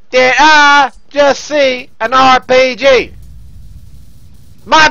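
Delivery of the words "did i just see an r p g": very slow, word-by-word question; emphatic repeat. Real, not acted. Gamer repeating the RPG question, emphatic repeat. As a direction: spell it out slowly, word by word. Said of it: "I said".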